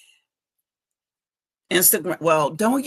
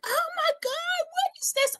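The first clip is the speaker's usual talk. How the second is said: mock scream; 4 dB quieter than usual. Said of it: "I started screaming like".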